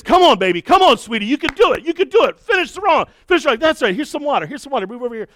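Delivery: high, loud encouraging cheer; cheering kids on. Retold or imitated. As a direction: pump up the struggling runners. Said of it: "I got other kids".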